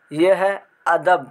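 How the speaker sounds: normal talking voice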